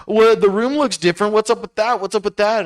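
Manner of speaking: mocking her high voice. Ex-girlfriend voiced in story.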